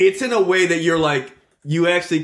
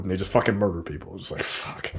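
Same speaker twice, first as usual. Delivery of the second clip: whispered; 7 semitones lower, 8 dB quieter than usual. Retold or imitated. Whispered dread reaction.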